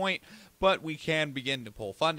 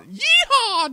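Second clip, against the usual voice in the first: hammed-up cowboy accent yelling yeehaw, much higher and louder; 13 dB louder than usual. Podcaster joking about his cowboy accent, loud mock cowboy whoop. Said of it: "I can do a cowboy accent pretty well".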